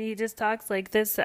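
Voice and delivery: mock an annoying froggy voice; Patrick Mahomes. Host mocking quarterback's annoying voice, Kermit-like and high. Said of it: "that fucking Kermit the Frog voice".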